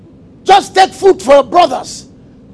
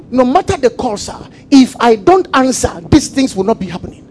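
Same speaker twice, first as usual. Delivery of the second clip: usual speaking voice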